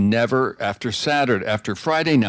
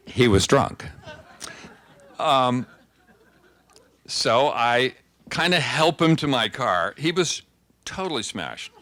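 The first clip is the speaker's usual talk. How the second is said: ordinary talk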